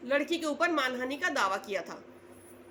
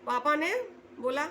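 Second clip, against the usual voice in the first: usual speaking voice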